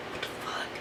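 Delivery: barely audible, voiceless whisper; stunned whisper. Retold or imitated. Woman reacting to a stranger touching her foot, stunned whisper. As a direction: whisper it under your breath.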